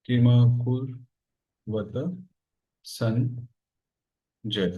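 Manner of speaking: low, very slow Sanskrit recitation with long gaps; slow verse dictation. Shown as a demonstration. Teacher reciting the Gita's first shloka to students, slow dictation. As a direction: dictate the verse word by word, drawn out. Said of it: "पहला श्लोक है गीता का".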